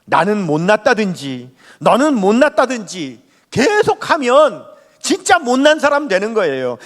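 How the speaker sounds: ordinary delivery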